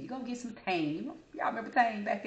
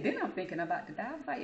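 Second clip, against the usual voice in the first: ordinary talk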